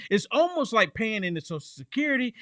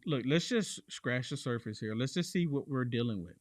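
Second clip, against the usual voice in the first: imitating Trump; 6 semitones lower, 6 dB quieter than usual. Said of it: "President Trump was saying".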